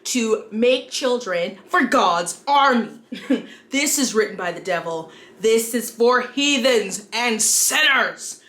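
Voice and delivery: outraged prudish character, hammed up; hammy stage actor. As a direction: overplay a scandalized conservative. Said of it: "thank you if you watched through my terrible skit".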